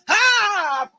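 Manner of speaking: high, drawn-out "Haah!"; sudden high whoop; dancer's jump shout. Retold or imitated. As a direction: holler it like leaping mid-dance. Imitates dancers jumping up in videos.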